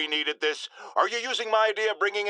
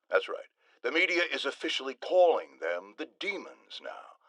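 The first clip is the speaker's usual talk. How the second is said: ordinary delivery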